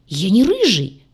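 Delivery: loud, pitch leaping up in protest; indignant exclamation. A narrator's reading. Demon denying he is ginger, exclaiming. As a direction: cry out in offended denial. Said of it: "воскликнул Двар".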